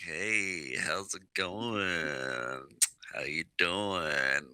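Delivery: low, slow, smooth come-on; flirting. Acted. Adventurer chatting up a woman on the street, flirting. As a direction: draw it out like a soft pick-up line. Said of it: "had been flirting with you".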